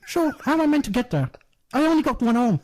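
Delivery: hammed-up Irish lament about his arm; mock Irish one-armed man. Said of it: "I was doing both parts".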